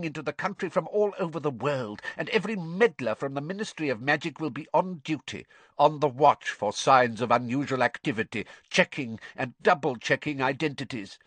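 cold, impatient master lecturing a fool; Lord Voldemort. A narrator's reading. Narrator voices the cold master rebuking servant.

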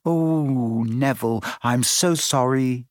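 ordinary delivery

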